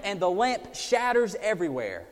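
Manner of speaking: ordinary talk, nothing marked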